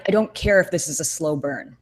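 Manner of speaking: ordinary talk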